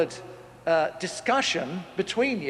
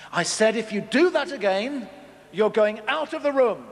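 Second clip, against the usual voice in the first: a bit higher and louder second warning; firmer warning; 5 semitones higher, 3 dB louder than usual. Retold or imitated. Parent repeating warning to child, rising firmness. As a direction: say it again with more insistence. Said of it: "mum or dad says".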